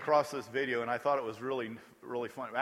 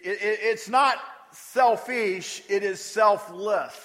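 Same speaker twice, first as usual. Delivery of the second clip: "selfish" and "selfless" drawn out slowly, louder; slow emphatic contrast; 4 semitones higher, 5 dB louder, much slower than usual. Shown as a demonstration. Preacher setting selfish against selfless, slow and deliberate. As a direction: spell out the contrast word by word. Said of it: "Notice he uses the word".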